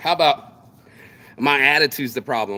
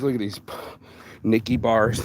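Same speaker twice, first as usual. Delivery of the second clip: ordinary talk, nothing marked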